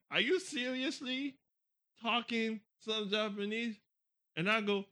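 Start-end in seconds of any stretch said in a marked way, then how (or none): 0.1-3.8 high, slow imitated disbelief; incredulous. Wife staring at husband speaking Japanese, incredulous.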